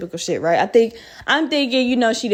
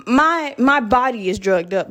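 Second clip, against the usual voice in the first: usual speaking voice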